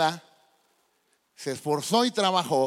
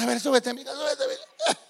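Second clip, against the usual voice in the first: a hint of a laughing, higher grandpa voice; lightly voiced besotted grandfather; doting grandpa; 8 semitones higher than usual. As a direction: indulgent old man chuckling as she climbs on. Grandpa happily lets girl climb.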